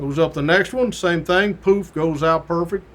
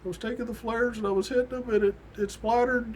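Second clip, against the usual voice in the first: sheepish rookie; 7 semitones higher, 6 dB quieter than usual. Retold or imitated. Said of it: "He's like".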